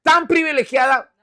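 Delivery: hammed-up admiring agreement; Jesús, mock gushing. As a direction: pretend to be deeply impressed. Imagined Jesus gushing over Mary.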